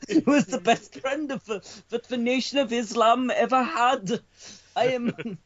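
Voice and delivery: bad Welsh accent, sing-song and solemn. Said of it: "it has to be a Welsh newsreader".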